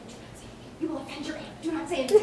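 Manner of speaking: soft, breathy hushed warning; a mother hushing her child at the dinner table; hushed mom warning. Retold or imitated. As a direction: hiss the warning under your breath like a mom. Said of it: "in her mom way".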